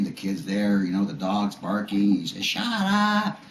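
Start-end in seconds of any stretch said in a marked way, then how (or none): 2.4-3.4 high, loud yell to silence the house; retold angry yell. Drunk husband to family and barking dog, angry yell. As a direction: imitate a drunk husband blowing up.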